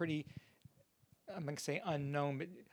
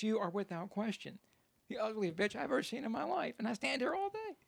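insulting parrot; 6 semitones higher than usual. Acted. Parrot to woman at the window, rising mock indignation. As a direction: pitch climbing through a mock rant.

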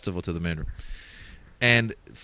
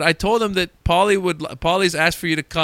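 yelled; 7 semitones higher than usual. Retold or imitated. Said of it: "This is all being yelled".

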